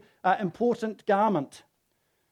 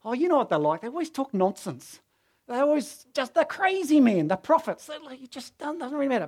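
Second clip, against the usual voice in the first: high-pitched, scoffing waving-away; dismissive brush-off; 7 semitones higher than usual. Retold or imitated. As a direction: play it down as crazy talk, pitched up. Jehu brushing off the prophet's message.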